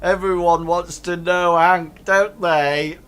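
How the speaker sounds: loud, high-pitched ruffian voice; jeering ruffian. Acted. Ruffian joking to his mates about Hank, loud and high. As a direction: call it out for the whole gang. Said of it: "one of them says".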